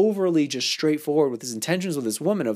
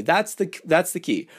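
ordinary delivery

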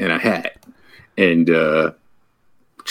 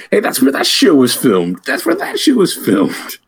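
imitating excited tourist; 10 semitones higher than usual. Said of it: "you'd just be like".

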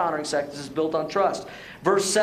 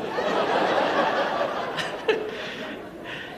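breathless laughter; real unvoiced laugh. Real, not acted. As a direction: laugh helplessly at his own joke. Still laughing at the joke.

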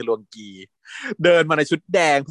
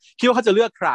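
called up in challenge; 8 semitones higher, 4 dB louder than usual. Retold or imitated. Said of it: "ตะโกนขึ้นไปบอก".

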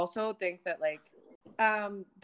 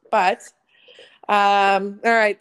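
loud segment switch; 13 dB louder, much slower than usual. Real, not acted.

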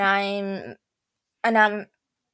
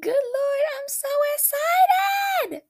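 very high, slow excited shout; excited shriek; slower than usual. Retold or imitated. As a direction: scream it with giddy joy. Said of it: "And I was like".

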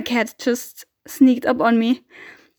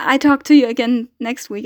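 normal talking voice

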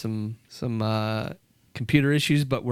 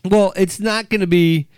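normal talking voice

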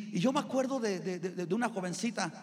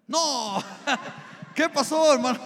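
laughing mock alarm; 4 semitones higher, 7 dB louder than usual. Mock alarm, laughing at seven wives.

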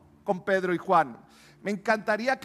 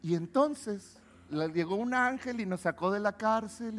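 softer, flat casual telling with no excitement; matter-of-fact; 2 semitones lower, 7 dB quieter than usual. Shown as a demonstration. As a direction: say it like an everyday event. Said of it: "Ah, pues es lo normal". (tono normal, sin emoción)

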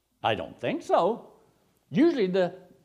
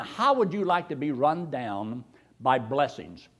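ordinary delivery

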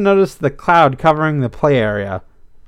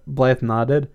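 plain reading voice

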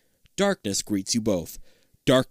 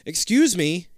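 louder; 7 semitones higher, 4 dB louder, slower than usual. Acted. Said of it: "you say a little louder".

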